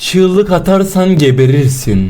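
slow, loud villain threat; drawn-out loud mock menace; menacing threat. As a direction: spell out the rules like a horror villain. Menacing intro to scary game.

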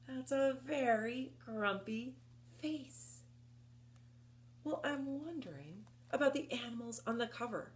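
usual speaking voice

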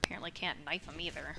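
usual speaking voice

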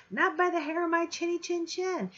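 high yelled pig voice; a hint of a defiant little pig. Teacher voicing the pig shouting back at the wolf. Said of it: "he yells out".

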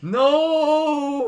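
long, high, loud cry; yelled "no". Real, not acted. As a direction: shout it out and hold it. Yelling during game.

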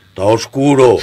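put-on a loud little boy's complaint; kid's voice. Boy hidden in the toilet to the lover, loud kid voice. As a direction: play the child stating it plainly.

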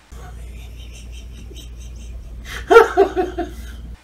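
breathy stifled laugh; airy giggle. Real, not acted. Husband giggling at his own joke, airy. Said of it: "mostly me laughing".

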